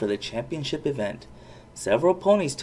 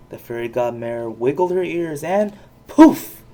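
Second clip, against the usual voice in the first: ordinary delivery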